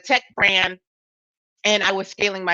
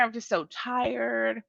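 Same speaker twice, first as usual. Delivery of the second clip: weary; 7 dB quieter, slower than usual. Retold or imitated. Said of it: "I was telling her".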